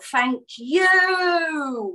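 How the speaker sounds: "Thank you," with the you stretched long and gliding down; drawn-out thank you. Shown as a demonstration. Reading Odile's message aloud to the group, a long drawn-out you. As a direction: read it as thank youuuu, holding the you. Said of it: "with lots of U's at the end".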